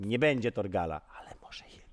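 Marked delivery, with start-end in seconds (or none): 1.2-1.8 whispered hint, barely there; hopeful whisper. Pretend. Host slipping in a hope after denying Torgal, whispered.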